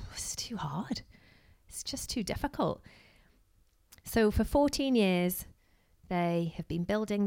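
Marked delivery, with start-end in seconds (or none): 0.1-2.8 whispered, giving up; defeated whisper. Retold or imitated. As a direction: breathe it out like you've given up.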